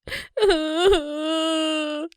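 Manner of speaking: high, drawn-out acted sobbing; long childlike wail. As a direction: cry on and on like a little cub. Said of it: "तुम क्यों रो रहे हो". (बच्चे का लंबा, ऊँचा रोना)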